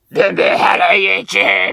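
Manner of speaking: hammed-up YouTuber greeting shout; Hikakin impression. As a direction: belt out a mangled catchphrase as a mimic. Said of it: "ヒカキン".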